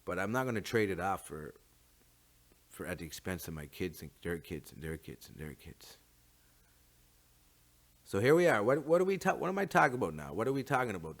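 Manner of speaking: ordinary talk, nothing marked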